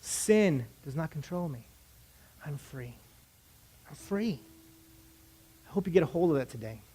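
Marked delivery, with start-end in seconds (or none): 0.0-4.4 low, soft, fading repetition; quiet declaration. Shown as a demonstration. Preacher closing with the declaration, hushed and low. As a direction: let it sink down almost to a whisper.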